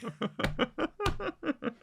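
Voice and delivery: high, helpless laugh; real laughter. Real, not acted. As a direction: crack up after your own bit. Laughing after his own impression.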